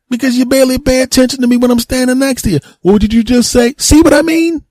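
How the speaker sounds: very high, indignant bickering; exasperated squabbling. Acted. Searcher bickering with a relative who never listens, exasperated. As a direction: escalate to a squeaky, exasperated pitch. Acted quarrel with inattentive relative.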